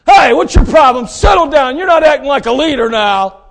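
loud, high, scolding shout at the master; harsh shout. Retold or imitated. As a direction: bark it like a brash servant. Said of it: "I guarantee he didn't do that".

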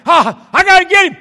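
loud, high frantic yell; frantic resolve. Retold or imitated. Man going after rat with broom, frantic yelling. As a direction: re-enact psyching yourself up at the top of your voice.

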